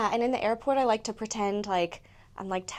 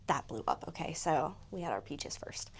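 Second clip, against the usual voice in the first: whispering; 3 semitones lower, 6 dB quieter than usual. Real, not acted. Whispered aside about the dog.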